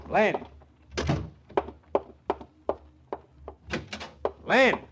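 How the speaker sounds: high call into an empty house. Acted. Detective calling for his missing client through her dark apartment, high call.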